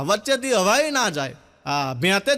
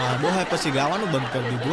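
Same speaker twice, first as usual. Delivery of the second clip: ordinary delivery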